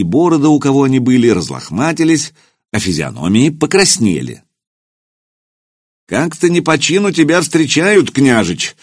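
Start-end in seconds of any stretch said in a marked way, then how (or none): 6.1-8.8 calling out over the noise; raised to carry over a noisy feast. Acted. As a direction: lift the voice over a crowd. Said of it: "повысив голос, чтобы перекричать шум".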